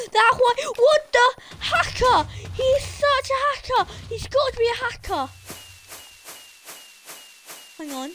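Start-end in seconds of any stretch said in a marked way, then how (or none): 0.1-5.3 high, loud accusation; gasp, then protest hotly at a cheat; indignant outburst. Real, not acted.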